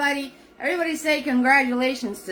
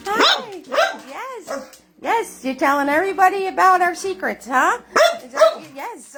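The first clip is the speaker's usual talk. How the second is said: high, playful pet-praising voice with laughs; talking to the dog; 7 semitones higher than usual. Real, not acted. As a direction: coo at your dog like he's the star of the show. Host talks to her dog on camera.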